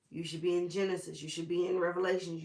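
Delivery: ordinary talk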